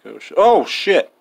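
sudden high startled cry of fright; startled yelp. Real, not acted. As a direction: jump out of your skin, loud. Startled by Anka appearing.

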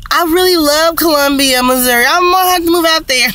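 real giddy excitement; excited. Real, not acted. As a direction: gush about the city. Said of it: "I'm so excited".

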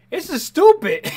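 high, loud and slow; exasperated outburst. Real, not acted. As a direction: throw up your hands, loud and high. Incredulous at robber.

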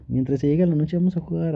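ordinary talk, nothing marked

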